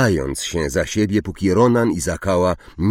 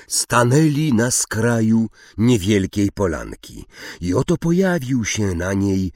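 usual speaking voice

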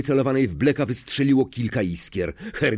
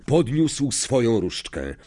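ordinary delivery

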